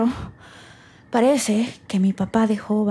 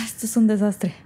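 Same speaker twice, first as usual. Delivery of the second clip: ordinary talk, nothing marked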